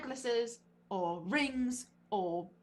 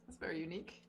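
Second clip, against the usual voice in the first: whispered aside; 7 semitones lower, 10 dB quieter, much faster than usual. Real, not acted. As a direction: breathe it under your breath. Muttered remark about boots.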